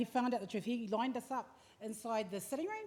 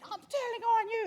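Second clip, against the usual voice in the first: upset sister voice; 12 semitones higher, 5 dB louder than usual. Retold or imitated. Upset older sister bursts in.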